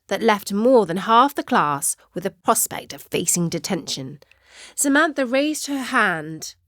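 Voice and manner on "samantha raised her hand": usual speaking voice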